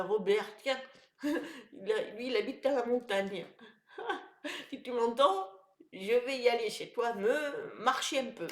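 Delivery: normal talking voice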